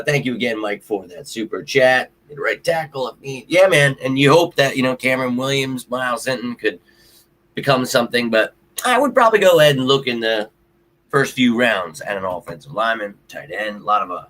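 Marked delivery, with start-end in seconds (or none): none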